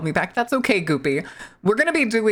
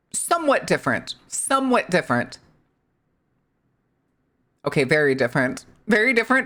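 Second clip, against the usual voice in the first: ordinary delivery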